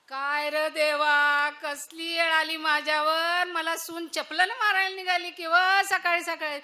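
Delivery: put-on loud, complaining old village woman; wailing mother-in-law. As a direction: cry out to her son in mock outrage. Mother-in-law wails to son. (तक्रार करणारी सासू)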